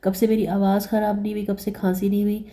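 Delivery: ordinary delivery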